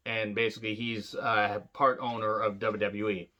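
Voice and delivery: ordinary talk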